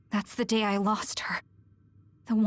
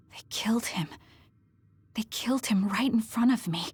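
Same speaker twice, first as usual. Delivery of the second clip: hushed, grieving whisper; stunned whisper. Acted. Trauma memory returning.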